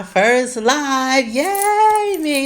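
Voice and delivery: slow, then a high, loud squeal of joy; excited squeal. Real, not acted. Host celebrates her first live, squealing excitedly. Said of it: "I'm so excited".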